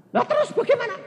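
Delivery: high, bewildered wife's voice; worried wife. Acted. Wife asking the dying uncle what now, high. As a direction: ask it high and puzzled.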